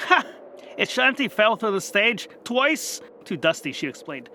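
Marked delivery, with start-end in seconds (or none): 0.1-0.2 guffaw. Acted. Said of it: "Scarlet guffawed". 0.8-3.0 amused excited recall. Acted. Scarlet laughing memory.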